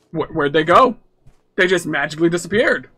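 ordinary delivery

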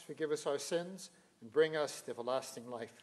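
ordinary delivery

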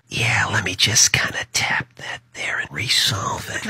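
low whispered aside. Acted. Sneaking past someone, whispering to self. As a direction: sneak the words out unvoiced.

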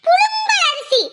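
high, sharp stretch on புழுங்கல்; exasperated emphasis. Acted. Wife confronting drunk husband over rice, exasperated and high. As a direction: hit புழுங்கல் high, with a long vowel.

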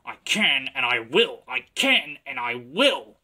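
loud, punched CAN and WILL; puffing determination. A narrator's reading. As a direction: chug it out doggedly. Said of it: "puffed James".

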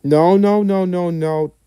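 urgent loud no, no, no. Real, not acted. Stopping a bad idea.

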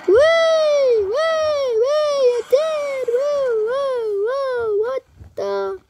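very high gleeful wees; excited squealing. Real, not acted. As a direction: cheer like you just won. Excited cheering during game.

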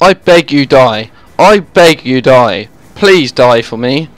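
slow, low begging; weary, measured entreaty; deliberate pleading. Real, not acted. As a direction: plead word by word, drawn out and low. Begs the enemy to die.

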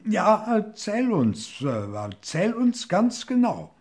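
nervously eager to obey; anxious crocodiles. A narrator's reading. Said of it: "und riefen". (hoher, ängstlich-eifriger Ruf der Krokodile)

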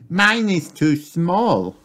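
high slow little piglet voice; patient little girl piglet explaining to Daddy; Peppa's voice. Acted. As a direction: spell it out slowly, childlike and high.

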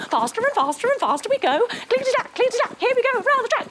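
speeding up. Pretend.

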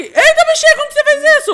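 high excited astonishment; amazed. Pretend. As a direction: blurt it out in wonder. Amazed at new power.

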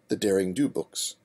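ordinary delivery, nothing marked

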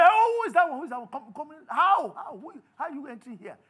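loud, high startled challenge of an intruder; challenging gatekeeper. Acted. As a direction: act out a guard demanding who dares enter. Guards challenge a careless intruder.

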